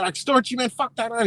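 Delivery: high-pitched mock griping; imitated bitching. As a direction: gripe like an irritated caller. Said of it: "He was just bitching".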